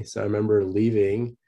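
ordinary delivery

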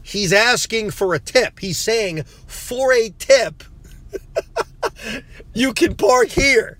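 high, slow, laughing through the words; cracking up while explaining the tip; laughing dad. Retold or imitated. Dad to son about the attendant, laughing as he explains. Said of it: "my dad looks at me and says".

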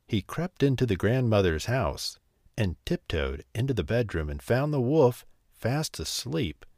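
ordinary delivery, nothing marked

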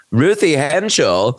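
imitated clueless waitress; mishearing waitress. Waitress mishears and blurts a name.

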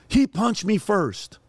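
put-on high, pleading boy's voice; son screaming upset. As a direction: plead like a kid in tears on the phone. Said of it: "I hear my son in the back screaming".

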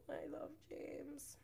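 soft whispered fond aside; a whispered I love James. Real, not acted. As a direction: breathe it softly, affectionately. Fond aside after laughing.

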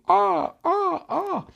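high, repeated squawks like a bird in flight; high imitated bird calls; bird cry. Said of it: "Ella vuela como un pájaro". (graznidos agudos de pájaro)

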